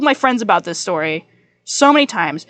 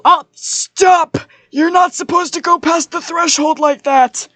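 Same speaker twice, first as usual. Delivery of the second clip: mock scolding; 5 semitones higher, slower than usual. Real, not acted. Scolding an intruder, voiced.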